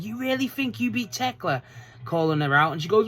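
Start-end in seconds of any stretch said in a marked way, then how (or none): 0.0-1.6 high-pitched mocking imitation; Alex Windsor. Fan mimics a rival calling out the champion, high imitation. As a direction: pitch it high like a sceptical challenger. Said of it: "and she's like".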